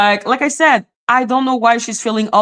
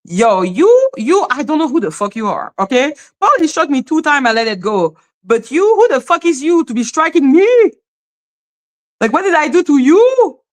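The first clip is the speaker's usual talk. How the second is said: retold indignant outburst, rising higher; mad and annoyed; 4 semitones higher than usual. Confronting the woman who struck the streamer, retold annoyance. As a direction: challenge her in disbelief, pitch climbing at the end. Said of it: "mad at her and annoyed".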